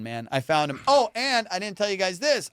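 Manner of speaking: normal talking voice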